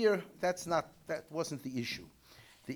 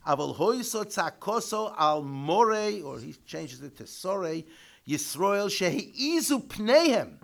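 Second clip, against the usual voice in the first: text reading; 3 semitones higher, 10 dB louder than usual. Acted. Reads Hebrew commentary text aloud.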